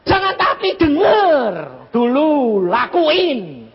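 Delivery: loud, high, drawn-out command; emphatic admonishing. Retold or imitated. Preacher to doubting mother, loudly admonishing. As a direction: cut off the objection, stretching each order. Preacher cutting off her objection.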